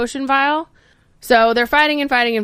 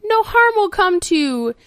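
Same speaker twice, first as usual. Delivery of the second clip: slow, high, careful promise; very specific; 8 semitones higher, much slower than usual. Retold or imitated. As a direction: weigh each word slowly. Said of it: "Lady Boyd very specifically goes".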